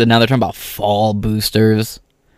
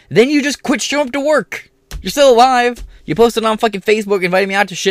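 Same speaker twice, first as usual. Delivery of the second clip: much higher, louder outraged yelling; angry rant; 10 semitones higher, 4 dB louder than usual. Real, not acted. Ranting at coworkers who quit, high loud outrage. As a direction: blow up at the hypocrisy.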